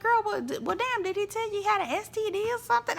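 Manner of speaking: mocking retort to Kiki; mock sassy retort. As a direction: fire it off fast and sassy. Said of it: "I'm like".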